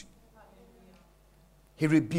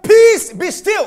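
higher, louder, slow rebuke; commanding rebuke; 5 semitones higher, 5 dB louder, slower than usual. Acted. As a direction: rebuke the wind firmly. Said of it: "He said".

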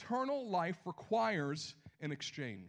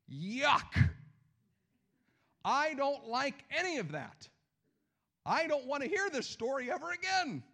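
mock disgust; 7 semitones higher, 5 dB louder than usual. Preacher voicing a disgusted listener.

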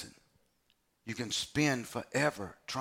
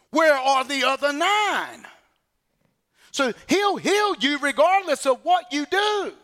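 full-force preaching; 9 semitones higher, 6 dB louder than usual. Acted. Preacher pressing the congregation, loud and climbing. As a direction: thunder it at the congregation.

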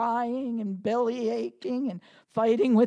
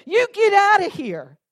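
loud, higher command barked at the devil; commanding shout; 5 semitones higher, 8 dB louder than usual. Retold or imitated. Pastor rebuking the devil with a commanding shout. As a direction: order it out with force. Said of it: "When I say".

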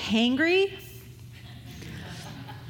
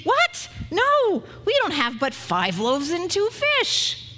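much higher, louder indignant "What? No"; shocked pushback; 7 semitones higher, 3 dB louder, slower than usual. Retold or imitated. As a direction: bark it in disbelief, then push on. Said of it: "A better translation might be".

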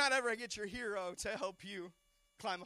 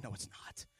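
lower, whispered no, disbelieving; whispered disbelief; 8 semitones lower, 6 dB quieter than usual. Retold or imitated. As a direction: hush it like you can't believe your eyes. Wife doubting in retold scene.

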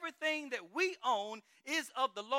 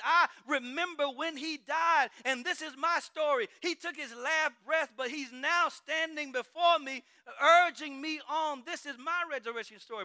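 fervent testimony; 5 semitones higher, 5 dB louder than usual. Acted. John tells his story.

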